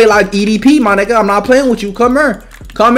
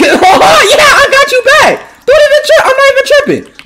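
laughing high shout; 13 semitones higher, slower than usual. Real, not acted. Laughing reassurance after hit.

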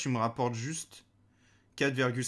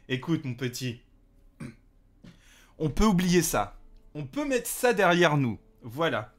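mock mobster; 3 semitones higher, 6 dB louder than usual. Blackmailing a character in game.

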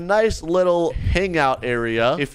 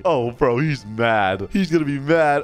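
normal talking voice

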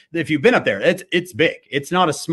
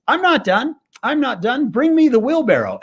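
higher, teasing showman call; lightly voiced daredevil showman; showman's boast; 10 semitones higher than usual. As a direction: tease the crowd with it. Daredevil announcing another crossing.

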